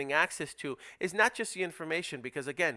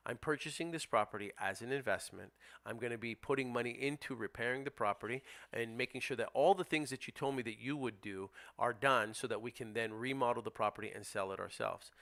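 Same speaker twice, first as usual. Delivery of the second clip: softer, calm buyer's pitch to the seller; calm, reassuring script; 4 semitones lower, 8 dB quieter than usual. Shown as a demonstration. Investor calmly telling the sellers he can't pay more. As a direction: reassure the seller gently, firm underneath. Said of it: "that's how you handle that objection".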